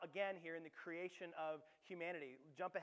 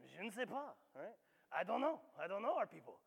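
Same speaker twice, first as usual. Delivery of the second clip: bad French accent. French ambassador shrugging to the townsfolk, in a bad French accent. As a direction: play the clueless French ambassador with a weak French accent. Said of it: "I don't have a great French accent".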